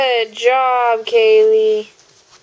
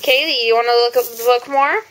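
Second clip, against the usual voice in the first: put-on reading to a toddler. Mother reading animal book to toddler.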